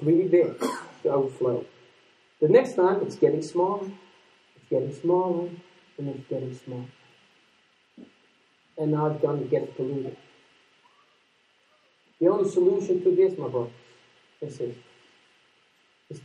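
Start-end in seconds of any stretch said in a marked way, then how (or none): none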